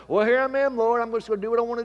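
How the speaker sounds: loud, high, careless mock sinner; hammed-up nonchalance, rushing the end; mock flippant. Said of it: "You can't come to God and say".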